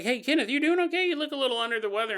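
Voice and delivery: high, quick mock concern; concerned friend. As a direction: rattle it off like a worried buddy. [name] asks sick Kenneth.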